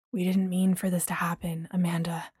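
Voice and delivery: whispered, low remorse; soft apology. A narrator's reading. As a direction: apologise softly.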